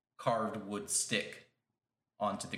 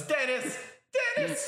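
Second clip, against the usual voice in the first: very high, louder panicked yelling; desperate call; 4 dB louder than usual. Acted. Creature swept away crying out to Dennis, high panicked yell. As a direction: shout for help as the water takes you. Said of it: "then the guy goes".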